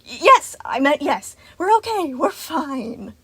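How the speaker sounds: high, hasty self-correction easing down; flustered recovery. A narrator's reading. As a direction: cover up a breakdown.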